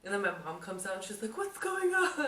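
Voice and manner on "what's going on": high, loud mom voice, laughing; alarmed mom. Retold or imitated. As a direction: play the mom rushing out in alarm.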